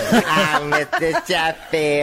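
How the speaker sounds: a high Fran Drescher squawk; Fran Drescher impression. Asked for Fran Drescher, a high nanny voice. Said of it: "You sound like Edith".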